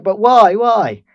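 loud, high, pleading protest; indignant shout. Retold or imitated. Stuck passenger protesting to police, loud high outrage. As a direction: shout it like a man who won't accept no.

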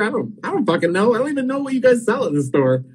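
high-pitched retold bewilderment; exasperated protest. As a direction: act out clueless indignation, pitch climbing high. Retold protest at being asked to order.

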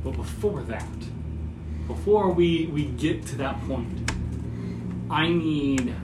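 slow, low, soft narration with long pauses; hushed, deliberate tabletop narration; game master scene-setting. Acted. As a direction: set a grim scene, quietly and unhurriedly.

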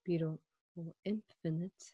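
ordinary talk, nothing marked

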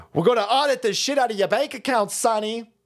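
hammed-up threat to the chat; mock tough guy. Threatening the women in chat, in a mock tough-guy voice.

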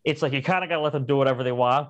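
ordinary talk, nothing marked

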